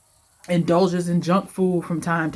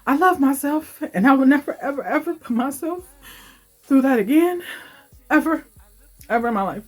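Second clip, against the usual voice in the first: emotional; 8 semitones higher, 3 dB louder, faster than usual. Real, not acted. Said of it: "this is getting me a little bit emotional".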